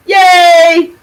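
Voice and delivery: high, loud happy whoop; excited cheer. Real, not acted. Cheering about costume job.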